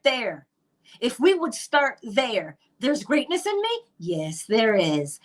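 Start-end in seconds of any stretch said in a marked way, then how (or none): 2.8-3.8 high, wondering question of a doubting listener; doubtful surprise. Pretend. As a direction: ask it as if you can't believe it. Imagined listener doubting herself.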